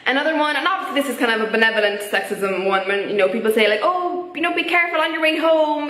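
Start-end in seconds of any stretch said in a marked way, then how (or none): 3.8-5.9 higher, fussing well-meaning voice; put-on cautioning tone; mock concern. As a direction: imitate a well-meaning worrier, pitched up. Voices people giving patronising advice.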